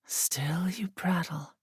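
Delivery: slow, soft, disdainful whisper; scornful whisper. Acted. Air spirit whispering scorn at the chattering human. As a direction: sigh it out with contempt.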